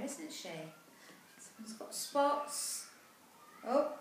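plain reading voice

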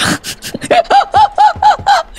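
shrill burst of giggles; high laughing squeal. Real, not acted. Laughing at blue. (높게 터지는 웃음)

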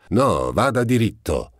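sharp call from inside the cab; shouted order. A narrator's reading. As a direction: yell at the driver to keep going. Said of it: "gridò la stessa voce".

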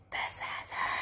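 secret whisper to the captain; conspiratorial whisper. Retold or imitated. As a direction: hush it so she can't hear. Pointing out the lady secretly.

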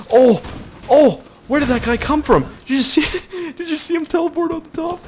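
very high, loud startled outburst; laughing astonishment. Real, not acted. Gamer sees an enemy teleport, high laughing amazement. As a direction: exclaim in disbelief, breaking into laughs. Enemy suddenly appears out of nowhere.

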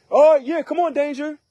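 high-pitched cocky taunt; hammed-up, high daredevil voice; mock bravado. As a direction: play someone foolishly daring danger to come. Mock daring someone to bring danger.

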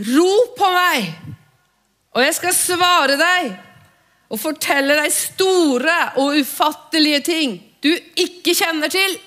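high, loud proclamation of the promise; ringing call. Shown as a demonstration. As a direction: cry out the verse. Roper Guds ord høyt. (høyt, ropende forkynnelse)

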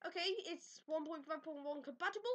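ordinary delivery

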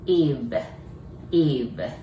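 v sound demo; lip-bitten v. Shown as a demonstration. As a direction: bite the lip to sound the v in "Eve". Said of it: "唇を噛みます".

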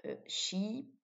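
sheep with a drawn-out ee vowel; long I in sheep. Shown as a demonstration. Teacher showing French learners the long I in sheep. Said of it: "avec un long I".

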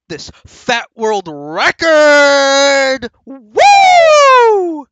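slow, very loud, high triumphant shout; hype shout. Real, not acted. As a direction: bellow it like an announcer. Hype shout about own record.

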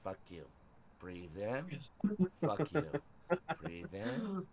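soft, hushed mock meditation; mock calm breathing. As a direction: breathe it out as if finding peace. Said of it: "I'm breathing".

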